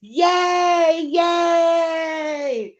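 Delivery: loud happy yay; celebrating shout; excited cheer. Real, not acted. Delighted at gift reply.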